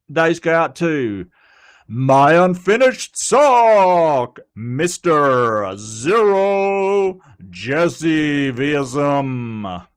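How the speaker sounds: big, slow, booming announcer read. Pretend. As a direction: call the names like a ring announcer, drawn out and loud. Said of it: "get my announcer voice going".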